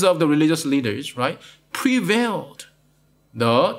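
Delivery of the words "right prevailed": ordinary delivery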